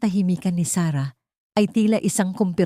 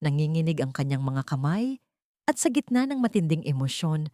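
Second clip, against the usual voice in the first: plain reading voice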